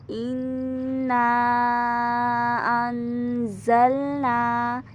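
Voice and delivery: इन्ना अंजलना drawn out slowly; slow recitation. Shown as a demonstration. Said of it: "मद जायज़ कहते हैं". (इन्ना अंजलना धीरे खींचकर)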